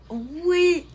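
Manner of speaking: high, loud whoop of yes; elated cheer. Real, not acted. Streamer hears good news from a viewer, elated cheer. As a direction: let out a stretched, triumphant yes.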